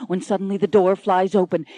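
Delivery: ordinary delivery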